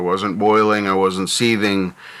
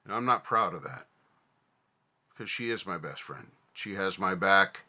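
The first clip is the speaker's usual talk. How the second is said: sad and ashamed; 12 dB quieter than usual. Real, not acted. Vlogger admits to viewers he hurt his best friend, soft and ashamed. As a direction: confess it quietly, ashamed. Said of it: "I am sad by my failure".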